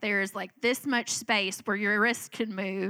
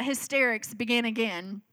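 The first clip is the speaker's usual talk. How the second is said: ordinary delivery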